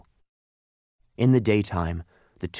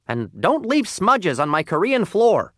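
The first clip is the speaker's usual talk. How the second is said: loud, high-pitched scolding; scowling owner's parting order; rude restaurant owner, scolding; 10 semitones higher, 5 dB louder than usual. Acted. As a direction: scold loudly after someone leaving. Owner scolds the departing coal.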